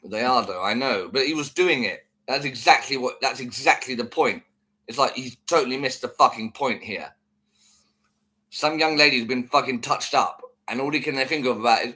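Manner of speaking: ordinary talk, nothing marked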